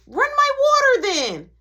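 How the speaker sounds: put-on high, loud kid demand; bossy kid brother. Little brother demanding his bath water, high and loud.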